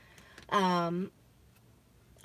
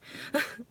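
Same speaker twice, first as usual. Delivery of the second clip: whispered laugh; 7 semitones higher, 6 dB quieter than usual. Real, not acted. Nervous laugh after whispering.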